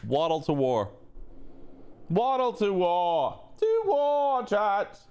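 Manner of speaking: rally them, climbing up high; rising rally cry. Acted.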